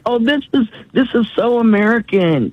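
high, sing-song mock of a smug remark; mocking quote. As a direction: sneer it out like a know-it-all. Mocking what people say.

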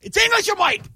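very high teasing squeal; mock squeaky voice. Host teasing co-host over British accent, mock squeaky voice. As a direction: repeat the jab in a tiny high voice.

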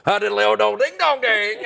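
put-on high, gibberish parody of a Southern accent; mock Southern accent. Strangers mimicking an Alabamian's speech, mock Southern accent. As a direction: imitate people badly mangling a Southern accent. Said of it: "people I meet think they can do my accent".

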